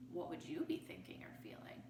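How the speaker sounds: quick hushed whisper; whispered question. Real, not acted. Teacher asking the children, in a quick whisper. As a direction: draw them in softly.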